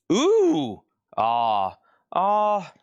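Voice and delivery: high, loud ooh and ah of delight; excited squeal. Real, not acted. Seller thrilled by a record, squealing ooh and ah. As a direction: squeal with surprise at the find.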